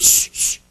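two quick hushing shushes; shushing. Retold or imitated. Church folk silencing seekers, soft shushing. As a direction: shush them softly. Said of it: "they tried to shush him up".

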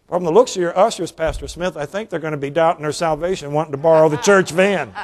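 usual speaking voice

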